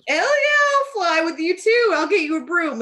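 high, loud eager agreement; mock gung-ho friend; eager yes. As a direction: hype it up like a keen friend. Excited friend retold.

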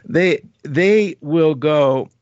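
very slow, drawn-out put-on Libra voice; a light mock Libra, slow and loud; imitating a Libra. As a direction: stretch it out like a Libra weighing things up. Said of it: "here's a, here's a Libra".

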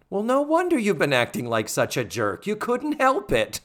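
lightly acted sitcom punchline, rising high at the end; Natalie. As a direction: deliver it as a mock punchline. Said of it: "there's a funny Natalie line".

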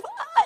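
high squealing shriek; retold shriek. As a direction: squeal in fright. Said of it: "you know, like that".

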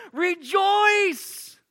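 excited Rejoice. Acted. Gabriel greeting Mary, excited shout. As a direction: burst out with joy. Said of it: "this excitement as Gabriel's like".

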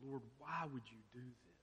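soft, hushed plea to the Lord; hushed lament. Retold or imitated. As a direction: murmur the complaint quietly. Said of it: "and say".

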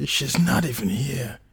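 high, strained, grief-stricken lament; gruff voice. A narrator's reading. John grieves for her in his apartment, gruff. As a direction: choke it out high, as tears come. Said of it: "speaking in a gruff voice".

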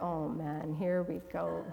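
low, soft resigned aside; dreading. Retold or imitated. Speaker to herself before speaking on panel, dreading. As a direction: sink into quiet dread.

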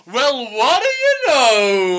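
loud, high, very drawn-out boast; hammed-up gleeful Grandpa Joe. Joe reveals he can stand, hammed-up slow holler. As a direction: stretch every word in mock amazement.

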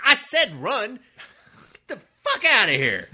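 high, loud mock command; angry hero shout. Hero driving the old woman away, hammed-up angry yell. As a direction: bellow it, hammed-up and impatient. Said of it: "He's like".

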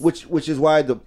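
ordinary talk, nothing marked

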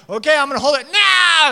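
loud, high imitated tantrum wail; mock toddler scream. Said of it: "and, like, screaming".

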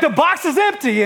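high-pitched, laughing through the words; laughing disbelief. Retold or imitated. Dad to the congregation, retelling the empty box, laughing high. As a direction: crack up as you repeat it. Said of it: "I was like".

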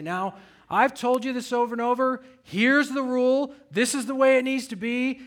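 frustrated dad re-enacted, loud and high. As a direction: lay down the law loudly, pitch jumping up. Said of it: "I start raising my voice".

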